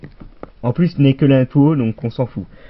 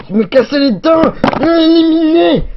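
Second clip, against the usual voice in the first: much higher and louder cartoon cry; eliminated toy figure; 7 dB louder than usual. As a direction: shriek it out as the toy knocked out. Figure knocked out in fight.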